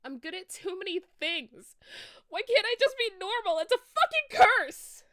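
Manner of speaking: building to a shrieking yell; shout. A narrator's reading. Enoby screaming at Draco before running away, furious. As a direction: scream it at the top of your lungs. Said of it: "I shouted".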